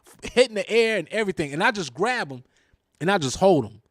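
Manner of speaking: ordinary talk, nothing marked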